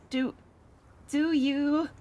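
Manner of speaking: stammering. Acted. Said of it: "choke out a stammered".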